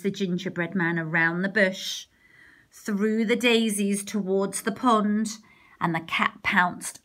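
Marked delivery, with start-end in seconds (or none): none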